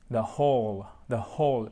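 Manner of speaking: THE WHOLE pronounced "the hole"; falling, careful "the hole"; silent W. Shown as a demonstration. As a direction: breathe an H to start "whole", no W. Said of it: "on ne prononce pas le W".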